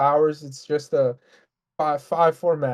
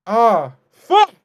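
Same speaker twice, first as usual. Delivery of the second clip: frustrated outburst; 5 semitones higher, 11 dB louder than usual. Real, not acted. Worked-up rant boils over.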